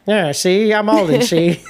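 put-on licht gespeelde nep-mengelmoesaccent van Alden; Alden impression. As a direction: zet speels Aldens verzonnen accent op. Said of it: "That's your Alden?".

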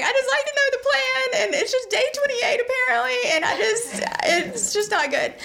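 very high mock sobbing squeal; tearful wail. Patient falling apart in front of her doctor, mock wailing. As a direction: blubber it in a hammed-up crying voice, half laughing. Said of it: "puddles of tears".

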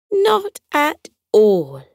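slow, smiling aunt voice. A narrator's reading. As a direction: say it with a smile. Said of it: "she said and smiled".

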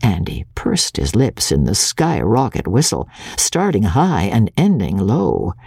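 usual speaking voice

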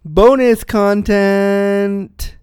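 loud, slow, drawn-out announcer intro; booming show-opening announcement. Acted. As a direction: announce it big, stretching out the words.

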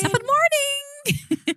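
a very high, slow, mock Irish lilt. Reading the listener's message aloud, slow high mock Irish. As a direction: stretch it out like a stage Irishman. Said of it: "it's an Irish saying".

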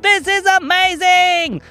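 high, slow, stretched cheer; thrilled panda. Acted. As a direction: exclaim in pure delight.

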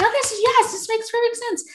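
very high, delighted imitation; squeal-pitched glee without extra volume; overjoyed. Acupuncturist confirming his diagnosis to the patient, overjoyed. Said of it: "Why are you so happy?".

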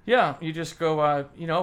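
ordinary talk

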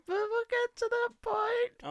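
falsetto, slow teasing; mock prissy falsetto; squeaky high voice. Teasing hushing of partner.

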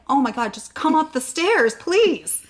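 half-laughing plea to just come up; exasperated call. Retold or imitated. Exasperated calling up the stairs.

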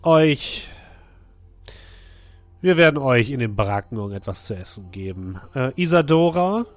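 loud commanding orders; masked ruler voice. Acted. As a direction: speak as a ruler giving orders. Masked woman giving orders.

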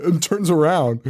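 ordinary talk, nothing marked

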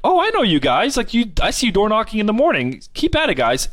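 high, cheery mock encouragement; friendly police chief. Police chief cheering on the salesmen, high cheerful voice. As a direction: beam at them like a jolly cop.

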